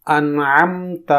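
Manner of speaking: انعمت slowly with a clear ع; teaching انعمت; slow careful انعمت. Shown as a demonstration. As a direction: stretch انعمت. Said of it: "اسے سنیے اور اس کے مطابق مشق".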